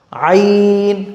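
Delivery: "आइन" from the throat, with a long held vowel; pronunciation demo. Shown as a demonstration. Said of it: "हलक से". ("आइन" हलक से खींचकर)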